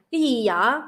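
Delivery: Southern Vietnamese, gì and vậy with y sounds; Southern Vietnamese accent. Shown as a demonstration. Teaching the difference to listeners, Southern Vietnamese accent. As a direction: give the Southern Vietnamese version. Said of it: "And the Southern accent be like".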